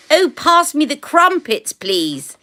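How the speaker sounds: high, plummy imitation of a posh Brit; posh mock British. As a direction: ham up a toff asking for crumpets. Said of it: "the way he said it".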